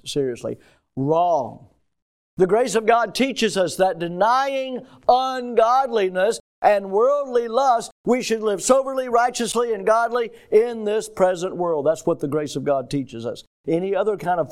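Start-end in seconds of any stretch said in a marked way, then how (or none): 2.4-11.9 scripture proclamation. Real, not acted. Preacher correcting a false idea of grace with scripture, forceful proclamation. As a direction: declaim the verse from the pulpit.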